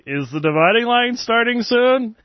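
high-pitched, amused parroting; hammed-up, high mimicking of a silly question; teasing mock question. Teasing blog readers, parroting their question.

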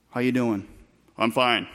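low, stoic small-talk voice; pitched well down, matter-of-fact. Acted. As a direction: answer stiffly, giving nothing away. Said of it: "Don't be so stoic".

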